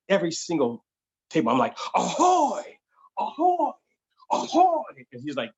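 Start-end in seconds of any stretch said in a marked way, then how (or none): 1.9-4.8 very high, louder sing-song ahoy calls; over-the-top ahoy. Retold or imitated. Waiter overdoing every ahoy as he greets tables. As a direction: go way too far with each ahoy. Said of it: "I didn't say go that far".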